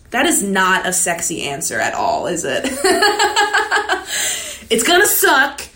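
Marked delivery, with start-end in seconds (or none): 2.7-4.6 high, bright burst of laughter at her own joke. Real, not acted. Laughing at her own unsexy answer to viewers. 4.7-5.6 emphatic. Real, not acted. Emphatic blunt advice to viewers.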